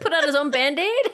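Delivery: laughing through the words; laughing question. Real, not acted. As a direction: ask it amused, mid-laugh. Laughing at the joke.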